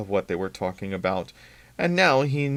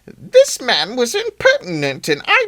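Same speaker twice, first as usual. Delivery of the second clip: much higher, louder, slow shrill dowager; indignant old lady; 12 semitones higher, 8 dB louder, slower than usual. Acted. Missus Deagle demands Billy's firing, loud outraged old woman. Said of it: "to assert herself".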